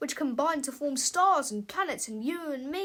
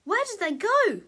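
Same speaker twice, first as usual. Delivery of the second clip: higher and louder alarmed cry; 8 semitones higher, 6 dB louder than usual. Acted. George cries out looking up into the sky, louder and higher. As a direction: call out to the empty sky. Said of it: "cried George".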